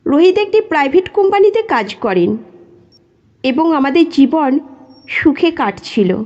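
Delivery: usual speaking voice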